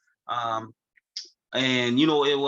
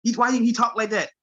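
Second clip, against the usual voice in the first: imitating island friend; 6 semitones higher than usual. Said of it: "That's what he said to me".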